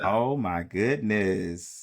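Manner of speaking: slow, drawn-out amazement; a slow, wondering oh my goodness; amazed. Real, not acted. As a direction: let the awe stretch out. Reacting to the album title.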